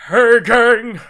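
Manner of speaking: loud, bright "Hey, gang!" call; big cheesy announcer greeting; cartoon announcer. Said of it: "different than my standard announcer voice".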